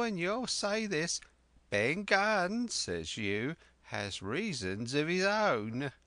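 put-on high, eager old castaway voice; an excitable marooned sailor rambling on; Ben Gunn. Marooned sailor telling Jim of Flint, eager and high.

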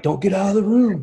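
high, loud, half-joking cry of alarm; hammed-up plea to stop everyone leaving; mock panicked yell. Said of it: "I'm like".

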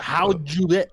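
mock aggressive. Shown as a demonstration. Friend demonstrating the Dutch shut-up phrase, mock aggressive. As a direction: bark it as a pretend telling-off. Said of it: "say it like you're pissed".

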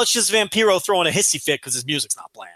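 ordinary talk

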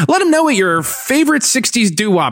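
high, chipper church announcer; upbeat stage announcer voice; announcements guy. Retold or imitated. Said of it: "the announcements guy is, like".

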